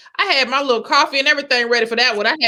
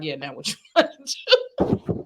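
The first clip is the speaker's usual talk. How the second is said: imitating Michael; 6 semitones lower, 4 dB quieter than usual. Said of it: "be like".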